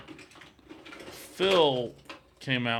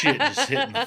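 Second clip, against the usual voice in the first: pitched way up, cracking into laughter; laughing high; 6 dB louder than usual. Real, not acted. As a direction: let the laugh take over the words. Laughing at the mowing chaos.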